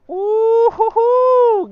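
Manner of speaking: long, slow high-pitched whoop; high falsetto whoop. Real, not acted. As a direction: whoop it up like a cheer. Cheering at boss fight.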